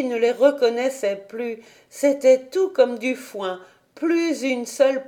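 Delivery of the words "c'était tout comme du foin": plain reading voice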